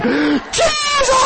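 very high, crying-out "Jesus"; screaming Jesus. Retold or imitated. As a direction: wail his name. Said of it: "I was just screaming".

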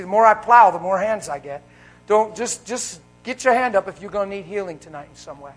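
loud, driving preacher call; rally the room to raise hands; preaching at full force. Acted. Preacher urging the congregation to raise their hands, loud and driving.

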